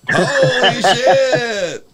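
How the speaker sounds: drawn-out loud holler of surprise; excited shout. Real, not acted. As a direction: belt it out, thrilled. Caller's surprise call-in greeting.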